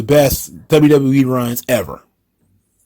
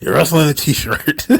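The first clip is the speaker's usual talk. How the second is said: breaking into laughter. Retold or imitated. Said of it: "I'm not gonna get that one out".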